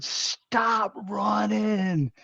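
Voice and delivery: high, drawn-out call; imitated distant shout. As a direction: stretch the words like a far-off warning. Retold faint shout heard while running.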